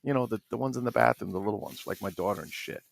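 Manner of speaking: usual speaking voice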